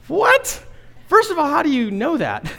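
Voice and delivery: hammed-up startled yelp into a high question; mock shocked. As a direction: squeal it in exaggerated alarm. Acts shocked reply to demon claim.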